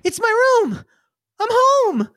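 put-on high-pitched little-boy delight; overjoyed kid. Kid finding himself in his own room, high joyful cry. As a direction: cry out high, thrilled to be back. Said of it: "and he goes".

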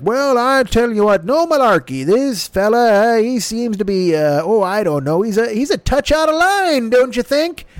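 hammed-up Biden ramble; Biden impression. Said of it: "when Biden is like".

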